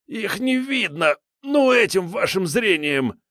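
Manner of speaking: high whiny frightened thug; a cornered petty crook pleading; scared thug voice. A narrator's reading.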